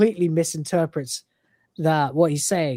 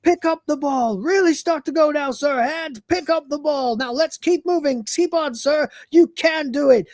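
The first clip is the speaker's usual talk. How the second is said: high urgent coaching, getting frantic; building crazed coaching; 11 semitones higher than usual. Retold or imitated. Kryten urging Lister's new hand, louder and crazier. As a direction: cheer him on, rising to a frenzy. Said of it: "go louder and more and crazy".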